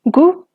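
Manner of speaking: "Gu" rising up to a high tone. Shown as a demonstration. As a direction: say "Gu" on a high pitch. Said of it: "Todas estas palabras tienen tono alto".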